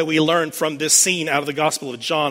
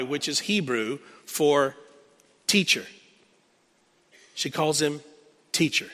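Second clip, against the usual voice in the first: normal talking voice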